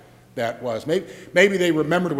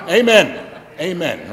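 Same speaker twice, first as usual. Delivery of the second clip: mock amen; 3 semitones higher, 6 dB louder than usual. Shown as a demonstration. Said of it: "Everybody try it".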